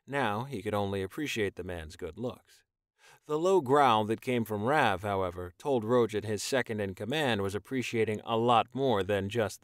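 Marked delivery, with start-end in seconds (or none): none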